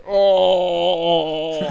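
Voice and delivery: long, high, drawn-out "ohh"; exaggerated high swoon, held long; imitated melting coo. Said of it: "they both just went".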